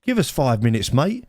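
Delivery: high, stern rebuke, slow ending; angry telling-off. Retold or imitated. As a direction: tell him off across the table. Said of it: "And he went".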